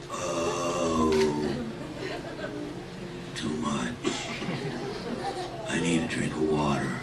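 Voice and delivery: overfed wolf groaning for water; groggy stuffed wolf. Acted. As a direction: moan it from a too-full belly. Overstuffed wolf after eating.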